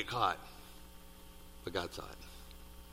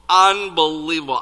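louder, higher "Unbelievable"; amazed exclamation; 5 semitones higher, 10 dB louder than usual. Real, not acted. Preacher reacting to the debt being canceled, amazed. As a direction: marvel at it out loud, punching the word. Repeats the word far bigger.

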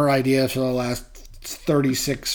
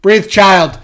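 urging to breathe; 4 semitones higher, 10 dB louder, much slower than usual. Retold or imitated. Calming son who can't breathe.

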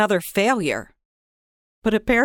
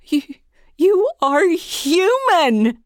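high, loud, slow gasp of disbelief; stunned discovery, voice leaping up; astonished; 9 semitones higher, 4 dB louder, slower than usual. Acted. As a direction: she can't believe her eyes. Destiny sees frog turned human.

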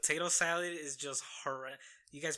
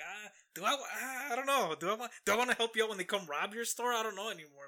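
put-on high, hesitant kid voice; unsure kid voice; 5 semitones higher than usual. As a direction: play a doubtful little boy. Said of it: "makes Macaulay Culkin question".

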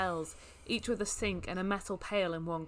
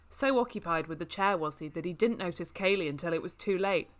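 ordinary delivery

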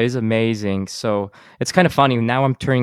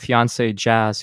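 usual speaking voice

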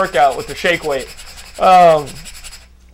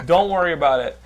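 normal talking voice

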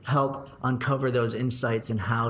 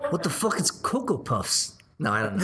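bad British accent; 6 semitones higher than usual. Guest answering the cereal question on request, bad British accent. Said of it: "in a British accent".

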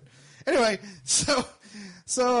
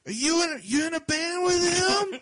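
put-on high, loud drunk woman prying; mock the drunk lady's nosy question; pitched-up drunk lady asking again; 11 semitones higher, 4 dB louder than usual. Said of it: "she's like".

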